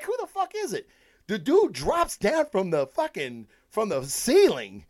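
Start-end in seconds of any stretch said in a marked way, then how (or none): 0.0-0.9 high-pitched incredulous question; baffled disbelief. Retold or imitated. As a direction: replay your bafflement, voice leaping way up. Acts out own baffled reaction.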